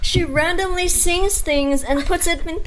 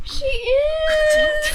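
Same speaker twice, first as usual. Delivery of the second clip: excited; 12 semitones higher than usual. Real, not acted. Hyping friend's trait.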